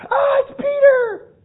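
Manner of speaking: high, loud startled shout; startled shriek. Acted. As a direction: shriek his name in shock. Rhoda sees Peter at gate.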